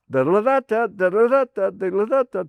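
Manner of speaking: high sing-song cartoon bird rattle; woodpecker call imitation. Asking who voices Woody Woodpecker, imitating the high cartoon call.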